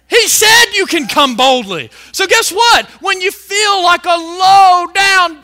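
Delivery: loud, emphatic pulpit delivery; forceful preaching. Acted. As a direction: drive it home to the congregation.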